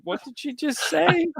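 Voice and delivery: high-pitched astonished question; mock disbelief. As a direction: squeak it out, acting dumbfounded. Retold astonished reaction to child.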